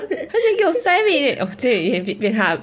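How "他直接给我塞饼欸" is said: much higher, outraged rerun of 他直接给我塞饼欸; indignant repeat. Shown as a demonstration. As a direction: throw the line up high in mock outrage.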